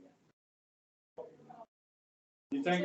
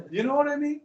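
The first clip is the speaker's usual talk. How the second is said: emphatic aside; 8 semitones higher, 8 dB louder, faster than usual. Real, not acted. Teacher high loud aside.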